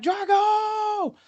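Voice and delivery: long, high, drawn-out yell; shout. Retold or imitated. At the top of the Rocky steps, a long high yell. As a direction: call it out triumphantly. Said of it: "I get to the top and I'm like".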